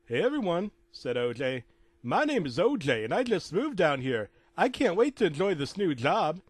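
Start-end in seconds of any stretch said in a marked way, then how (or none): 0.1-0.7 cheery newcomer. Acted. O J introduces himself to group. 2.0-6.4 high, loud, bouncy character voice; keen new-guy act; eager newcomer. Acted. O J introduces himself to group.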